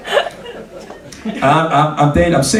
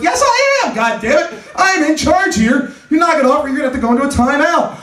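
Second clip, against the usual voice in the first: much higher, hammed-up stern parent; mock parental scolding; 9 semitones higher than usual. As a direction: lay down the law to a brat, half-joking.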